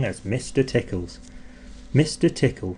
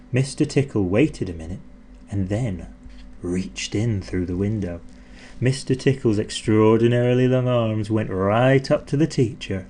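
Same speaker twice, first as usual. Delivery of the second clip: ordinary delivery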